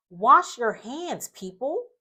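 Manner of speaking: pleading command. Real, not acted. Host tells viewers to wash their hands, slow loud plea. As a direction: drag out the order to the audience.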